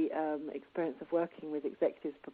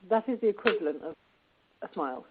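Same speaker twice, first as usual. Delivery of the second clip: usual speaking voice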